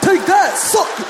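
high, loud hammed-up taunting shouts; mock gamer trash talk. Neighbor imitates the gamers yelling overhead, hammed-up loud trash talk. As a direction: yell victory insults into the headset. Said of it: "I'd hear".

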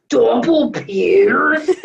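loud, laughing cartoon girl impression; Lola impression. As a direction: yell it like Lola through laughter. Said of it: "I can't even do her voice".

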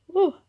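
playful ghostly woo; a spooky ghost hoot. Pretend. Spooky folklore mentioned.

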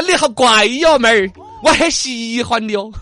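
重庆口音; Chongqing accent. Retold or imitated. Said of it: "重庆就要说".

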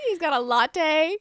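hammed-up fake British accent; really bad British accent. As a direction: put on a bad British voice, slowly. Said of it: "talking in a really bad British accent".

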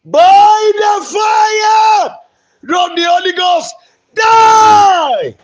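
very high, loud, slow stretched shout; laying a curse. Real, not acted. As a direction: scream the curse with full force. Preacher cursing a disease.

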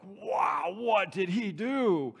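drawn-out, wowed reaction; mock astonishment. Students react to a weeping confession, mock astonished. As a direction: stretch it out in mock amazement.

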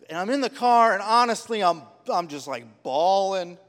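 emotional retelling. Retold or imitated. Retelling crying in the car.

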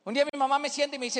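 usual speaking voice

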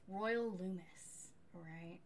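ordinary talk

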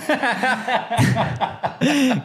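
long high laughing fit; real laughter. Real, not acted. Friend cracking up at a joke, amused.

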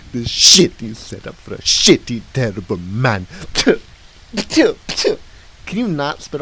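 loud, high, slowed indignant rant; angry rant. Acted. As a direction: berate him with theatrical scorn. Clown insults host's setup.

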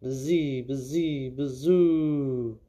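wind noise. A narrator's reading. Wind noise in ghost story.

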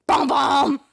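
loud, high vocal stinger; imitated TV sting. Capping the mock crime show intro, loud sting. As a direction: belt out a dramatic two-note sting.

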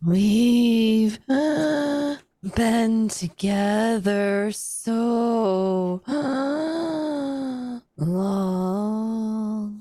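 slow, moaning words between long gasps; eerie, drawn-out creature voice; raspy, windy shadow creature. Acted. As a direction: draw it out in a wavering wail. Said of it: "you hear that long, raspy, windy voice".

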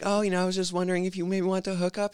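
high-pitched imitation of a woman caller; prank caller woman. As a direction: put on a high, coy pick-up voice. Voicing the female prank caller.

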